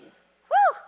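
high, loud delighted woo; joyful whoop. Real, not acted. Preacher whooping for joy at the verse. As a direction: let out a happy shout of joy.

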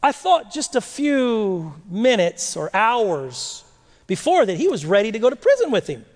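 normal talking voice

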